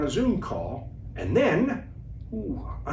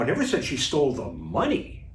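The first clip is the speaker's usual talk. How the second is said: "money" set apart with a questioning rise; stress on MONEY; faster than usual. Shown as a demonstration. Coach showing how emphasis changes meaning, stress on "money". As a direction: save the emphasis for "money", lifting it at the end. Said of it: "let's play with a few of those words".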